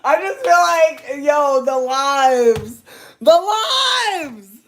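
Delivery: loud, high, drawn-out wailing; hysterical wail. Real, not acted. Reacting to Andrew's joke, loud hysterical wail. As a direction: wail slowly, overcome with laughter.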